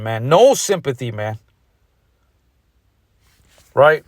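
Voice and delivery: usual speaking voice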